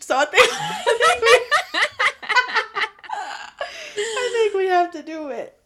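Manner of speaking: high, loud, through laughter; giggling while admitting defeat; laughing. Real, not acted. Laughing about redoing.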